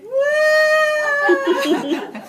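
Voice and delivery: very high, very loud mock baby crying; imitated toddler wail. Little sister pressed against the screen door, wailing.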